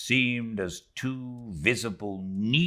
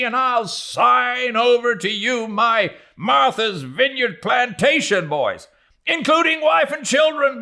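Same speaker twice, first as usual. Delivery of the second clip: much higher and louder, near screaming; wild frenzied shout; 7 dB louder than usual. Acted. Stubb whipping up his rowers, frenzied high shout. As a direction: roar the men on like a madman. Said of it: "And so shouting".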